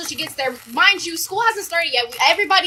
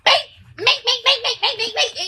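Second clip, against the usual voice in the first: rapid string of high-pitched hit sounds; imitated punch noises; 7 semitones higher than usual. Michaela goes in on the nurse, imitated punch noises. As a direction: rattle off cartoonish blows.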